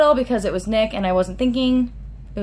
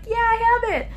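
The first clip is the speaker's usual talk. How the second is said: high, squealed fan reply; much higher, giddy fan voice; excited reply; 13 semitones higher than usual. Retold or imitated. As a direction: show off the book, thrilled. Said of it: "And I was like".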